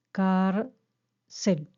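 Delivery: "Cárcel" split in two, first syllable drawn out; stressed first syllable. Shown as a demonstration. Teacher showing which syllable lasts longer, "Cárcel" with a long first syllable. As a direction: stretch the first syllable of "Cárcel", pause before the last. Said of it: "Dura más".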